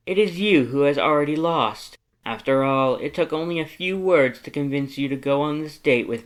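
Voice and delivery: loud, high, haughty one-upmanship; vain rivals bickering. Acted. Two pompous dates out-boasting each other, haughty and loud. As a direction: trade lofty insults like peacocks.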